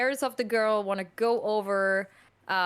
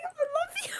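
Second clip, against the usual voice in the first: mock tearful diva; faster than usual. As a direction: whimper it like a melting diva. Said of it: "I'm like".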